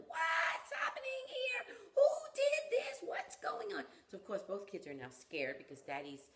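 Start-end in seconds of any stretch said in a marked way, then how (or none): 0.1-3.8 much higher, much louder, slow furious shouting; an upset dad yelling at the mess; angry yell. Retold or imitated. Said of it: "his initial reaction was to start yelling".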